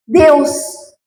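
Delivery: ordinary talk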